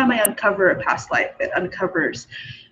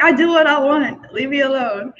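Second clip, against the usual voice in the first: higher, playful stand-your-ground retort; mock defiance; 4 semitones higher than usual. Goddess telling the jealous god off, mock defiance. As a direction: imitate a defiant refusal, half laughing.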